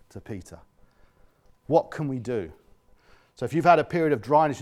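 usual speaking voice

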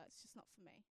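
dismissive whisper. Retold or imitated. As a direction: breathe it out as a flat brush-off. Laura dismissing church.

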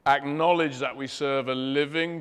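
ordinary talk

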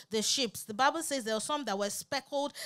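ordinary talk

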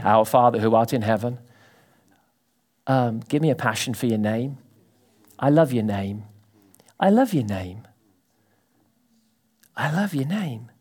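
low, soft, devoted murmur; intimate prayer. Shown as a demonstration. Believer alone with God, soft and devoted. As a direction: pray it alone in a quiet room.

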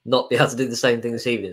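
ordinary delivery

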